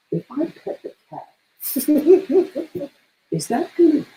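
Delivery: high-pitched worried questioning; mock anxious player. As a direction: play a gamer fretting over a tiny choice.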